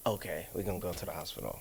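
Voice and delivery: soft, fast, sheepish okay; meek giving in. Retold or imitated. Giving in to his girlfriend about the hospital, meek and quiet. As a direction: cave in quietly.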